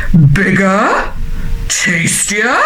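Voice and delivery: troll questioning with rising tune; lightly voiced troll, tempted; intrigued troll. A narrator's reading. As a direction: ask it greedily, swooping up. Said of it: "he cried".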